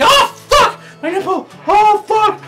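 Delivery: shrieking high with a laugh breaking through; frantic high shrieks; panicked screaming. Retold or imitated. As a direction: scream in horrified disbelief. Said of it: "and I'm like".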